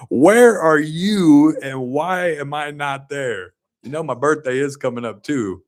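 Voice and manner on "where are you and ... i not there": laughing through it, slow; half laugh, stretched out. Retold or imitated. Mock jealous, laughing.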